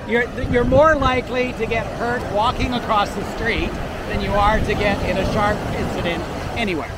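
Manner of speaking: normal talking voice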